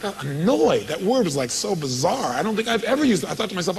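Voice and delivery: ordinary talk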